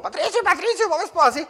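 put-on high, yelled child's voice, frantic; kid yelling in panic. Pastor retells the knocked-down boy screaming, high panicked yell. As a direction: shout it like a scared boy after the crash. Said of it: "empezó a gritar".

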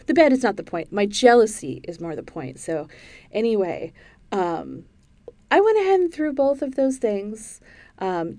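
ordinary delivery

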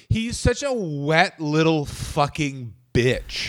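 slow and disgusted, spitting the insult; slow contemptuous sneer. Real, not acted. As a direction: drag it out slowly with scorn. Ranting about Greg Gagne.

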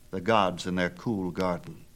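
usual speaking voice

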